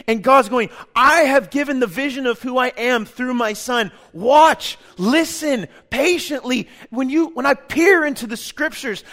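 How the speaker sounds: loud, driving sermon delivery; preaching at full force. Acted. Pastor urging the congregation to trust, preaching loudly. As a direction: preach it hard and build to the peak.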